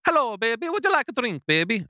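very high, hammed-up Italian charmer; Italian Mario accent. Shown as a demonstration. Husband offering his wife a drink in a very high fake Italian accent. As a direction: croon it like a cartoon Italian lover. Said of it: "Yeah, that's it".

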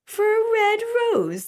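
very high, loud chorus of disbelief; incredulous cry. A narrator's reading. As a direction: squeal it out in astonished mockery. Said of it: "they cried".

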